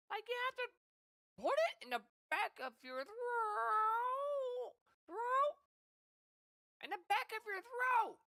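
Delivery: slow, high squeaky throat voice; Mickey Mouse voice. Shown as a demonstration. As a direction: stretch it out as Mickey. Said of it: "Like that".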